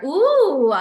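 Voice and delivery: a loud, high "ooh" of delight; impressed ooh. Real, not acted. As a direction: react as if struck by a great idea. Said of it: "that is powerful".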